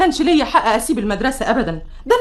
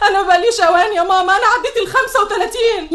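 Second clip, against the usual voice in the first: high, raised voice near tears; upset shouting; 6 semitones higher than usual. Acted. Daughter refusing talk of marriage, shouting high. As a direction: cry out at her mother, overwrought. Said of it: "يا بنتي ما تزعقيش كده".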